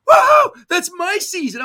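very high, loud, slow joyful shout; hammed-up whooping cry; excited shout. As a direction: yell it out in delight. Retold shout of joy.

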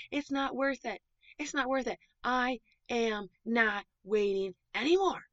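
high-pitched, hammed-up tantrum; super mad elephant. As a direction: throw a storybook fit, giving up in exasperation. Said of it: "him getting super mad".

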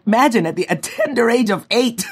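ordinary delivery

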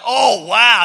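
big loud awestruck exclamation; mock amazement. Preacher mimics hearing an eloquent prayer, loudly amazed. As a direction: belt it out, acting bowled over.

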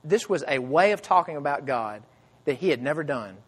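ordinary delivery

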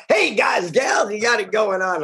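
high, hammed-up radio DJ imitation; mock DJ voice. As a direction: tease the host with an over-the-top DJ greeting. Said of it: "I love your D J voice".

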